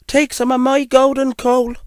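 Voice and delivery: high-pitched helpful little engine; high storybook engine character; gold engine. Acted. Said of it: "the gold engine told him".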